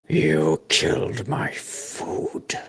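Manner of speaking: very slow, drawn-out monstrous rasp; deep raspy voice. Acted. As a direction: rasp each word out slowly, as a menacing creature inside their minds. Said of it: "a deep, raspy, uh, voice".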